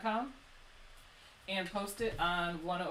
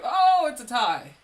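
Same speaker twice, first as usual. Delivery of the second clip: surprised; 4 semitones higher, 16 dB louder, slower than usual. Real, not acted. Live vote count, loud surprise.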